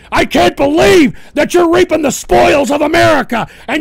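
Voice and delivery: high, loud, indignant disbelief; outraged yelling. Real, not acted. Host scolds listeners enjoying America's spoils, outraged yelling. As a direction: punch out each accusation angrily.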